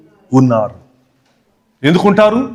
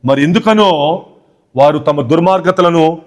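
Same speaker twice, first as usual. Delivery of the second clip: ordinary talk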